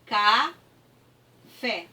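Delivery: "Café" slowly, ca... fé; separated syllables. Shown as a demonstration. Teacher separating the word for pupils, syllable by syllable. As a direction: leave a clear gap between the two syllables of "Café". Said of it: "eu vou separar".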